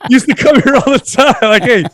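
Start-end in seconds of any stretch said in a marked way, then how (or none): none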